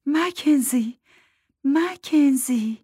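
high, gentle, lingering call of the name; soothing murmur. A narrator's reading. The woman soothes weeping Mack, murmuring his name. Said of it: "زمزمهٔ صدایش مثل قطرات آب خنک".

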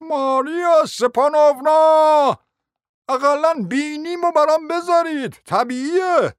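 high, drawn-out plaintive yell; aghast cry. A narrator's reading. Stunned prince begging to keep his nose, wailing protest. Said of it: "فریاد برآورد".

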